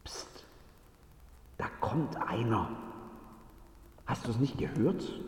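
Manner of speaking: leises, verschwörerisches Psst; Flüstern. Acted. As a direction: warnend flüstern, damit keiner es hört. Türwächter bemerken nahenden Boten. (leise geflüstert)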